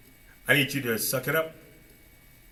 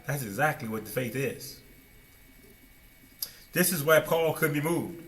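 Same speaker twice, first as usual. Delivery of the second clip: ordinary delivery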